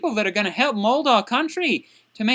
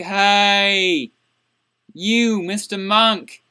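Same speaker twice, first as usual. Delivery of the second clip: drawn-out, confrontational hey; rude approach; 3 semitones lower, 3 dB louder, much slower than usual. Shown as a demonstration. Bad teacher accosting a student who missed school, rude and confrontational. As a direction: call out a long, heavy mock hey. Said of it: "Don't make 'em be like".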